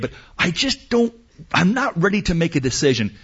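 high-pitched, flustered reluctance; indecisive. Acted. Voicing passenger who cannot decide.

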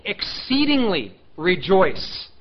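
EXCEEDINGLY drawn out slowly with weight; emphatic. Shown as a demonstration. Preacher showing the congregation a verse's emphasis, emphatic. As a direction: stretch out "Exceedingly", pause, then land "rejoice". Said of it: "because it's emphatic".

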